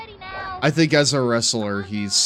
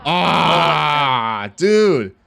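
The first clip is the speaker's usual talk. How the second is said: loud, high hyped yelling; excited shouting; 5 semitones higher, 6 dB louder, slower than usual. Real, not acted. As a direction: cheer it out like a stoked fan. Hyped reacting to wrestling clip.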